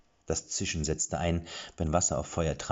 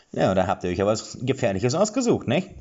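a louder mock-impressed dwarf; teasing dwarf; 6 semitones higher, 6 dB louder than usual. A narrator's reading. Dwarf teases the heroes about their choice, louder. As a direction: rib them lightly.

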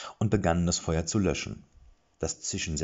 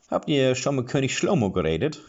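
higher, louder dwarf voice; dwarf asking; 8 semitones higher, 6 dB louder than usual. A narrator's reading. Dwarf questions the heroes, higher and louder. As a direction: probe them like a guard.

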